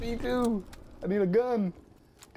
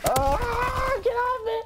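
yelping at an enemy player to get off; real panicked yell; slower than usual. Real, not acted. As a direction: shout him off you in a panic. Attacked in game, yelling at enemy.

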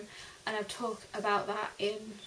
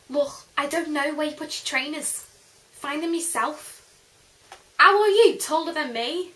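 put-on exasperated English accent; 5 semitones higher, 9 dB louder than usual. Scolding someone over lost trainers.

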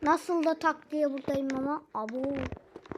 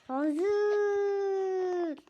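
mock whining; 5 semitones higher than usual. Button won't work, frustrated.

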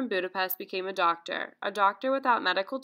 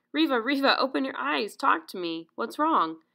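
higher, mildly urgent call; urgent rousing; 6 semitones higher, 3 dB louder than usual. A narrator's reading. Calling to feverish Riva to open her eyes, mildly urgent. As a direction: try to wake her with some urgency.

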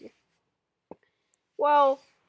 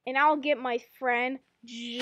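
usual speaking voice

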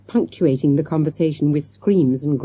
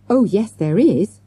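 raised, louder contradiction; crying out; 5 semitones higher, 5 dB louder than usual. A narrator's reading. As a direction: call out insistently, pitch up. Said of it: "cried Sasha".

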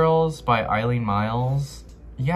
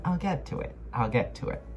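mock promise to self; 4 semitones higher, 5 dB quieter, faster than usual. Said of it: "you constantly keep saying".

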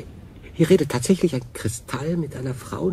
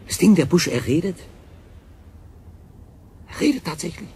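louder and higher, stunned; shocked outcry; 6 semitones higher, 3 dB louder than usual. Acted. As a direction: blurt it out in disbelief. Shock at a talking bush. (fassungsloser Aufschrei)

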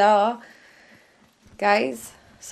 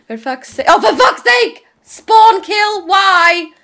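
usual speaking voice

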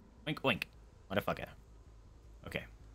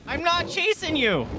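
high, pleading protest; exasperated high-pitched insistence; 12 semitones higher than usual. Real, not acted. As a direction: wail it to a fleeing player. Repeats protest to fleeing players.

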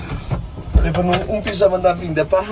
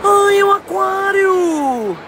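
amazed; 12 semitones higher, much slower than usual. Real, not acted. Amazed at the aquarium.

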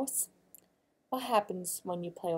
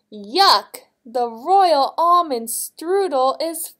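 loud, high exclamation of royal disgust; shouting king; 9 semitones higher, 13 dB louder, much slower than usual. A narrator's reading. King at breakfast finding sand in his strudel, shouting. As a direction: cry out loudly like an appalled king. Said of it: "one king shouted".

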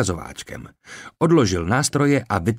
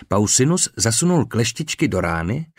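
ordinary delivery

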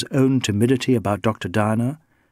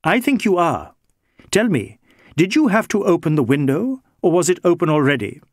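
lightly voiced inspector, pitched up, questioning; a hint of a higher-voiced police inspector; probing inspector; 6 semitones higher than usual. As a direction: press the suspect with pointed questions.

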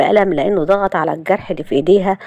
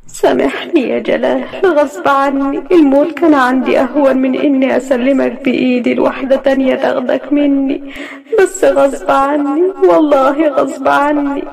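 tearful plea; 8 semitones higher than usual. A narrator's reading. Layla alone, tearfully begging absent Galal's forgiveness. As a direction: plead through tears, slowing down.

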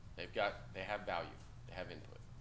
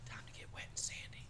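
breathy whisper, no voice at all; 10 dB quieter than usual. Retold or imitated. Instructor to SEAL recruit, whispered menacingly in his ear. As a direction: lean in and whisper it. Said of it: "Just whispered in his ear".

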